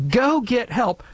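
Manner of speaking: acted emergency order; pointed command. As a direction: give one person a clear acted instruction. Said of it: "You say".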